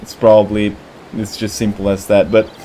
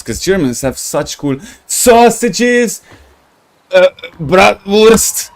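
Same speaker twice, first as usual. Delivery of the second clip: slow, higher hammed-up German accent on "bratwurst"; mock German accent; 7 semitones higher, slower than usual. Streamer musing to a German viewer in a mock German accent. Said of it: "speaking random shit with German accent".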